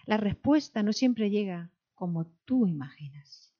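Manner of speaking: ordinary talk, nothing marked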